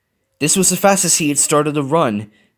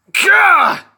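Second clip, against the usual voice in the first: effort shout; 8 dB louder than usual. A narrator's reading. Leap onto train.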